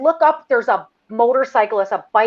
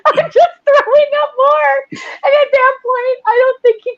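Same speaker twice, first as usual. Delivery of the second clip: words breaking into high laughter; laughing; 14 semitones higher than usual. Real, not acted. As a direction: laugh through it, barely holding together. Laughing while retelling the apology.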